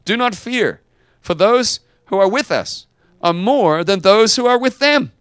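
rising preacher's proclamation; preaching shout. Acted. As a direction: ring it out to the whole room. Preacher proclaiming Elisha's words.

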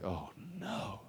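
very soft, low, sinking reaction; retold, under-the-breath dismay; hushed dismay. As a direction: let the dread drop almost to nothing. Retold dismay at bad news.

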